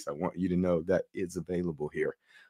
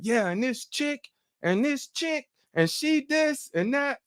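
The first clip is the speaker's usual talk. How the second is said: worked-up rant. Retold or imitated. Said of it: "I was hot about it".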